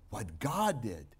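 usual speaking voice

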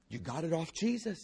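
ordinary delivery

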